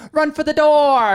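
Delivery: high, loud, slow playful call; joking shout. Retold or imitated. Boyfriend joking to his girlfriend on the podcast, loud playful call. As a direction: belt it out like a jokey command. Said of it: "I was like".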